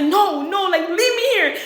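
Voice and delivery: mildly whiny retold protest; mock crying. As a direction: imitate a boyfriend crying like a baby. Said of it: "crying like a fucking baby".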